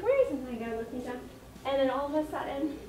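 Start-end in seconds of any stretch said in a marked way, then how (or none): 0.0-0.4 puzzled. Retold or imitated. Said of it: "I'm like".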